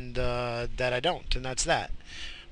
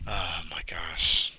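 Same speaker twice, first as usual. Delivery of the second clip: slow breathy whisper; tired whisper of disbelief; whispered oh my gosh; 5 semitones lower, slower than usual. Real, not acted. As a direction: whisper it, worn out. Whispered exasperation.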